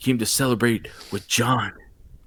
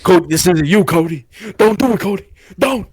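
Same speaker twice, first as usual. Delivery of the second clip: high, urgent mock begging; imitated desperate pleading; 7 semitones higher, 4 dB louder, faster than usual. John begging Cody not to do it, high frantic imitated plea. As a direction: plead frantically, climbing higher and higher.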